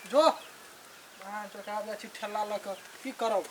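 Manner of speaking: put-on quiet drunken self-talk; drunken mumbling. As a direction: mumble low and soft to yourself. Drunk man muttering to himself.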